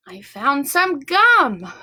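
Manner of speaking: slow, deliberate show-and-tell; slow teacher reveal. Shown as a demonstration. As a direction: draw it out for the kids.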